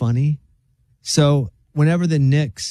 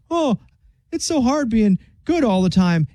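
Big Bird voice; 8 semitones higher than usual. Retold or imitated. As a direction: sigh it like a weary Big Bird. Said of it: "Big Bird's like".

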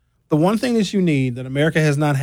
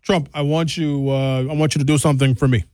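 mock Netanyahu. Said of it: "Benjamin Netanyahu was like".

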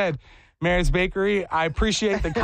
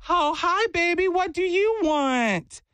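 high, drawn-out sweet-lady impression; Miss Glenda; 12 semitones higher, slower than usual. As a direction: play the bakery counter lady in falsetto. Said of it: "I acted like Miss Glenda".

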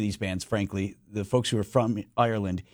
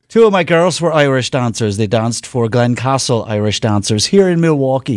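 put-on louder northern Irish accent; 9 dB louder than usual. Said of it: "You have a northern accent".

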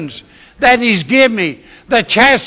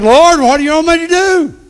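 much higher, louder pleading cry; desperate plea; 10 semitones higher, 5 dB louder than usual. Retold or imitated. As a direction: beg the Lord for direction. Retold plea to God.

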